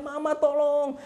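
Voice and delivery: high-pitched acted call for help; frightened cry. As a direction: cry out like a scared young bird. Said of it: "dia takut, dia teriak". (teriakan takut minta tolong)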